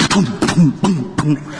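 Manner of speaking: mouthed booms of weapons firing; playful vocal gun blasts; imitated gunfire. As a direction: punch out short boom noises like gunshots.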